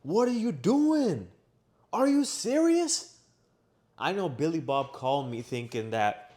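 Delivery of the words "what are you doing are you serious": loud, high, drawn-out angry questions; pissed off. Retold or imitated. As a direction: re-enact hollering at a friend in disbelief. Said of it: "but I was pissed".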